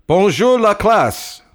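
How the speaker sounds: high, loud, slow teacher's greeting; sing-song classroom hello; old French teacher. Acted. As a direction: boom it out like a proud old professor.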